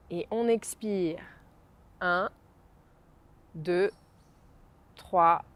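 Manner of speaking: soft, slow, spaced counting; guided breathing count. Acted. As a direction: count each breath calmly and slowly. Counts breaths slowly, softly. (décompte lent et doux de respiration)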